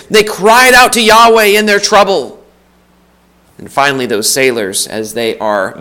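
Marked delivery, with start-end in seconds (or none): 0.1-2.3 cried out to Yahweh, high and loud, stress on out; full-voiced preacher's cry; preaching refrain. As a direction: proclaim the refrain with full force.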